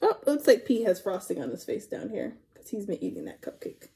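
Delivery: plain reading voice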